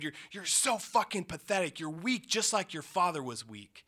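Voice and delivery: half-acted furious stepfather yelling insults; retold screaming. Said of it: "he's screaming what, the things he always screamed".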